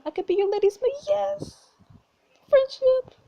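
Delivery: very high, loud cheer; excited squeal. Acted. Friend offers to come along as maid, excited. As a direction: squeal it with over-the-top glee.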